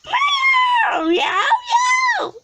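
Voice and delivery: high yowling cat meows; cat meowing. A narrator's reading. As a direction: yowl it like a cat. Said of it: "said Wilbur".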